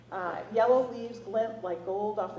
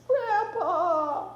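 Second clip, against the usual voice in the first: high, loud tearful cry; crying out for grandpa; 11 semitones higher, 10 dB louder than usual. A narrator's reading. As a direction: call for grandpa through tears. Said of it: "he wails".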